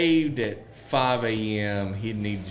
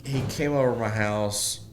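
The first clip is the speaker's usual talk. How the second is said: normal talking voice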